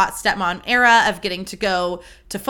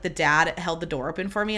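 ordinary delivery

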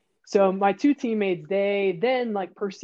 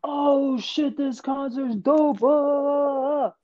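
high, loud, drawn-out whoop; mock hyped-up yell with stretched vowels; imitating excited shouter; 6 semitones higher, 4 dB louder, much slower than usual. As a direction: mimic someone hollering about a great show. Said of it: "you hear somebody that's like".

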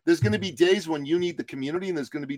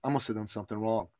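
inner thought; 6 semitones lower, 8 dB quieter, faster than usual. Retold or imitated. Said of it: "I'm thinking to myself".

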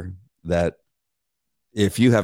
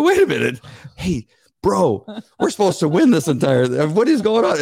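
mock baffled; 8 semitones higher than usual. Retold confusion at the dog.

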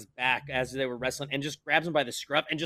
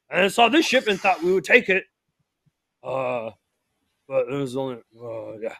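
crewman's halting account; 5 dB louder than usual. Acted.

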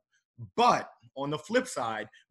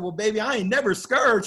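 cocky reply; 6 semitones higher, 5 dB louder than usual. Retold or imitated. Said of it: "I said".

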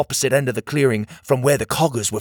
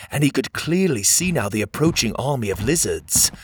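usual speaking voice

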